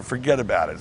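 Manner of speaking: usual speaking voice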